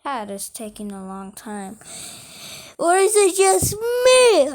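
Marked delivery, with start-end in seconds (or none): 2.8-4.5 yawning. Real, not acted. Yawning while talking.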